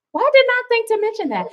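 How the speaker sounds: shrill-free squeak of mock disbelief at herself; high self-mocking voice. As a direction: ask it high and fast, poking fun at yourself.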